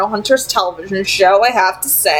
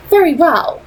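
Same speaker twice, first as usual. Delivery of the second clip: put-on higher, poised vampire lady with a faint accent; Camille; 8 semitones higher, 4 dB louder than usual. A narrator's reading. Vampire leader tempting Simon with an offer, poised and higher. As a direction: speak as a cool, persuasive vampire leader.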